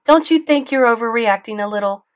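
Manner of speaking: loud, high angry tirade; mother ranting at the school board, loud fury; furious rant. A narrator's reading. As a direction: tear into the principal.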